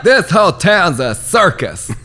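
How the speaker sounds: high, loud, slow outraged yell; Lindsey Graham yelling. Retold or imitated. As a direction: shout it like a furious senator. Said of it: "where he's, like, yelling".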